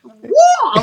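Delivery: high, sharp mock-startled whoa. Retelling how the question put him on guard, a high startled whoa. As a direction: pull back in alarm. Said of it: "I start getting a little, like, on guard".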